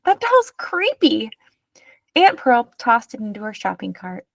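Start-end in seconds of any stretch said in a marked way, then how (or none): 0.0-1.3 shrieked. A narrator's reading. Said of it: "Marta shrieked".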